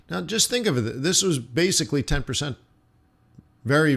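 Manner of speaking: ordinary talk, nothing marked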